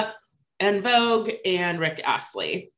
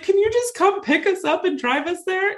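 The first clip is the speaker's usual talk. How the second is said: high, whiny mock plea; pleading whine; 13 semitones higher than usual. Asking husband Jeff to drive them, high mock plea. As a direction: beg for the ride in a high wheedling voice.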